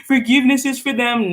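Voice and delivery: high, loud, preachy mock of do-gooders; mocking people's advice. Mocking people who preach forgiveness, loud and high-pitched. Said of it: "I can't stand when people say".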